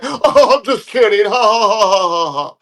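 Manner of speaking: hammed-up ha-ha, pitched high; mock laughing brush-off. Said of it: "He's like".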